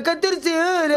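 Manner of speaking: mocking complainer. Doctor mocking the patient's long wait, put-on complaining voice. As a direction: parrot the complaint in a silly voice.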